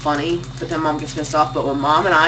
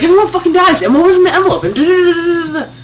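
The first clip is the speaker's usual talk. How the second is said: higher, louder mock rant; ranting dad; 10 semitones higher, 5 dB louder, faster than usual. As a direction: rant like a dad hating the ending. Dad ranting about ending.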